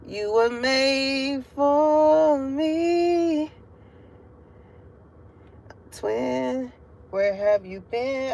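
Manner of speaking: channeled lover's voice. Pretend. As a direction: voice the message as a tender, drawn-out whisper from a soulmate. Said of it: "because I'm hearing".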